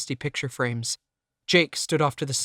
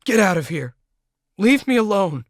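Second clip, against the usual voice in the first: much louder, higher furious yell; raging scream; 7 semitones higher, 8 dB louder than usual. A narrator's reading. Narrator raging at Jake and Cindy while smashing shelves, yelling. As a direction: scream them out.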